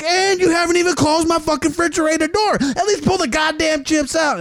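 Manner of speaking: high, furious yell; retold yelling. To the guest who left the fridge open, high yell. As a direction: berate him at the top of the voice. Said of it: "I'm yelling".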